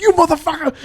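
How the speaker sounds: high-pitched mock cursing; retold yell. Swimmer re-enacting his anger at the raft guy, retold yell. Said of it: "and I yelled at him".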